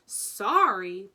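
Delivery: loud, indignant one-word echo; sharp loud exclamation; barked. A narrator's reading. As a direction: bark it back in disbelief. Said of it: "barked Hagrid".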